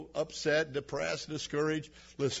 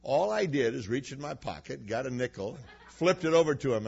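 ordinary talk, nothing marked

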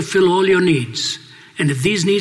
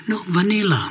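sweet waitress; 4 semitones higher than usual. Retold or imitated. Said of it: "she spoke in a sweet voice".

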